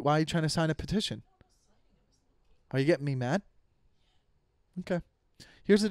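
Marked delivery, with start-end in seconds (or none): none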